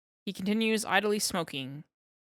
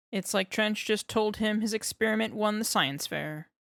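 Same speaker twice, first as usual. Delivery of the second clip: ordinary delivery, nothing marked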